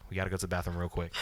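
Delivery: desperate to reach a bathroom, fast hushed urging; hurried urgent mutter. Retold or imitated. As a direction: hustle the cousin away, quick and low. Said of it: "and I was like".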